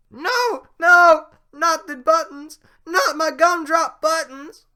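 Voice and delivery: shrieking high and loud; panicked scream. Acted. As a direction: scream in terror for the buttons. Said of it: "That's really loud".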